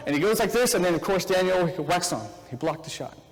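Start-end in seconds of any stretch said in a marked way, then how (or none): none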